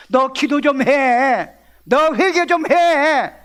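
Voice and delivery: loud, high, long-drawn command; God's urging call. Acted. God calling a troubled believer to repent, loud urging. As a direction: stretch it out as a booming summons.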